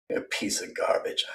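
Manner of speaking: voiceless whisper; whispered insult. Real, not acted. Insulting his rival in a whisper. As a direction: hiss it out under the breath.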